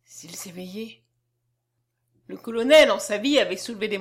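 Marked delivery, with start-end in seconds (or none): 0.0-1.0 slow, very soft whisper; whispered aside. A narrator's reading. Lecoq aside to the colonel, whispering. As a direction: whisper it low to an accomplice. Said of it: "Lecoq venait de dire au colonel tout bas".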